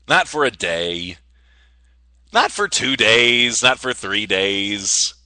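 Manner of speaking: slow, drawn-out countdown; very slow, measured storytelling tease; suspenseful build-up. Real, not acted. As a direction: stretch each phrase out for suspense.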